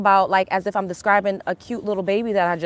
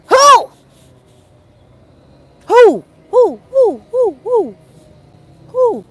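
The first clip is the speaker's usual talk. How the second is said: high, loud owl hoots of "who"; 10 semitones higher, 6 dB louder, much slower than usual. Pretend. Getting her owl on at the viewers, loud high hoots. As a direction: hoot each who like an owl. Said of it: "get my motherfucking owl on".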